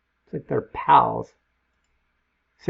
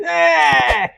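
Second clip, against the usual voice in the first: mock death scream; 6 dB louder than usual. Zombie murders him.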